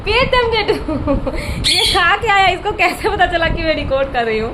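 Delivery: high laughing disbelief; amused high-pitched chatter to the parrot; laughing pet talk. Real, not acted. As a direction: laugh at the clever bird in a high voice.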